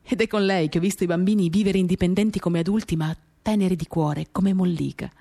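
ordinary talk, nothing marked

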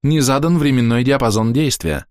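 chipper text to speech voice; neural network voice. A narrator's reading. Network reports error.

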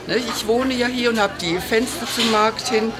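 ordinary talk, nothing marked

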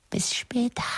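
very high, singsong farewell; mock-sweet goodbye. Taunting Jeannie with a goodbye, high singsong.

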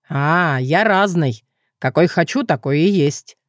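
usual speaking voice

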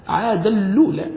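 عادا الأولى merged as "aadal-luula"; عادا الأولى with nunation assimilated into a doubled lam; doubled lam with damma. Shown as a demonstration. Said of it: "بتشديد اللام".

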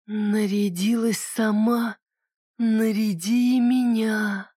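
slow, rustling whisper of a doll; rustling ghostly voice. Acted. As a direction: breathe it out slowly like dry rustling. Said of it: "прошелестело за спиной".

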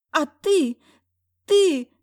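high, loud sputtering wife; flustered ex-wife. A narrator's reading. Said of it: "Не нашлась, что ответить".

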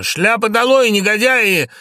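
high, loud furious command; angry shout. Acted. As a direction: thunder it out in outrage. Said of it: "Лоренцо гневно".

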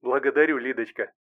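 softened computer voice. A narrator's reading. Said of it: "Синтезированный голос смягчился".

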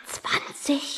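hushed surprised whisper; whispered question. Real, not acted. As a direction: breathe the number in disbelief. Whispers throughout.

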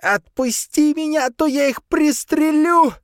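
high, loud, slow snarl; snarling threat. A narrator's reading. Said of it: "прорычала Сабрина".

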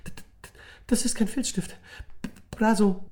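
put-on boy horrified at the wrong pen; horrified stutter. A narrator's reading. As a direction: blurt it in shock. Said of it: "sagte er entsetzt".

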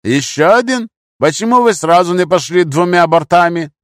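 high, loud interrogation; harsh demand. A narrator's reading. Gunman grilling captive about second boat, loud demand. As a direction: fire the questions forcefully.

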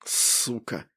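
тихое шипение сквозь стиснутые зубы; сдержанно прошипеть ругательство; прошипел сквозь зубы. A narrator's reading. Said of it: "прошипел я сквозь зубы". (шипение сквозь зубы)

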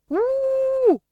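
very high, loud wailing howl; howling along. A narrator's reading. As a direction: join the wail at full pitch. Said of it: "îi ținea isonul cealaltă". (urlet foarte ascuțit, ținând isonul)

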